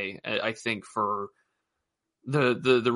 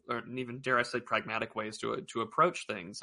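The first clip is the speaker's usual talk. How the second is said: ordinary talk, nothing marked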